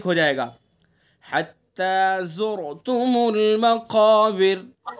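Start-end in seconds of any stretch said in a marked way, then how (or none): 1.3-4.9 slow melodic tilawat with long drawn vowels; slow Quran recitation. Shown as a demonstration. Teacher recites the surah for students to copy, slow melodic recitation. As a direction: chant each verse slowly, stretching the vowels. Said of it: "اب یہ را بتائیے کیسی ہوگی مقابر کی؟".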